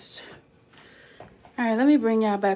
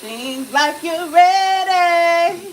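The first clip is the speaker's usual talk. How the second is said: slow, loud, higher teasing voice to the food; drawn-out tease; 7 semitones higher, 12 dB louder, much slower than usual. Acted. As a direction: stretch it out like teasing the mac and cheese. Talking to the dish, drawn out.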